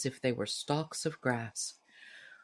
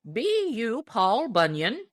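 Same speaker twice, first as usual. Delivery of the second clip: high and loud, slow question; old man calling; 7 semitones higher, 5 dB louder, slower than usual. Acted. Deacon calling up to the giant, high old voice. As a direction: hail him like an old-timer.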